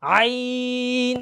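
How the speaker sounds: আইন drawn out very slowly from the middle of the throat; গলার মাঝখান থেকে আইন; throaty ayn. Shown as a demonstration. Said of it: "একটু জোর গলায় বলা লাগে".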